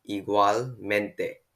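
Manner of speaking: igualmente very slowly, split as igual-mente; drawn-out, careful igualmente with a break before mente; slow model pronunciation. Shown as a demonstration. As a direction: sound out igualmente for a learner. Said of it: "repeat after me".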